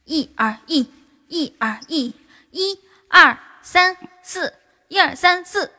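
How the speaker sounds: mock jogging cadence, getting loud; barked drill commands. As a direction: chant the marching count. Goes off jogging, counting cadence.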